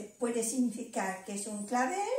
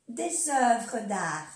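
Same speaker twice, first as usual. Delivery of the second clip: "Des œuvres d'art" muy despacio y marcado; despacio, para que lo repitan; lento y claro; much slower than usual. Shown as a demonstration. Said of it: "ejemplo lento". ("Des œuvres d'art" dicho muy lento)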